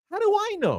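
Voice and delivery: high-pitched, comically helpless retort; exasperated protest. Retold or imitated. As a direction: throw the question back, voice way up. Protests being asked about hamster.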